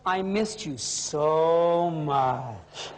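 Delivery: low, very slow, hammed-up longing; milking it. Husband milks the surprise call to his wife, low slow mock longing. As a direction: stretch every word, laying the affection on thick. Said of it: "He starts to milk it".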